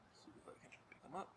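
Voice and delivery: hushed whisper, trying not to scare it. Real, not acted. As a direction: whisper so he doesn't fly. Sneaking up on a bug.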